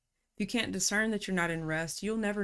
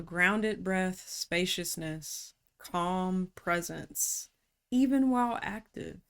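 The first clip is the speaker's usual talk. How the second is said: slowly modelling the calm she describes; slow calm cues; slower than usual. Shown as a demonstration. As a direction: stretch out each word, slow. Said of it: "lists calm cues slowly".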